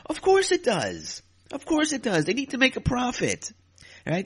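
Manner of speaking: high mocking "of course it does"; sarcastic. Real, not acted. Answering trolls with high sarcastic scorn. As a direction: talk down to the complainers, pitch way up.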